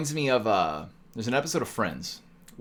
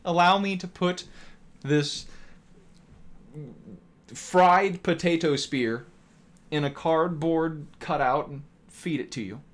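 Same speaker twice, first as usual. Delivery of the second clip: mock gracious server; 7 semitones higher, slower than usual.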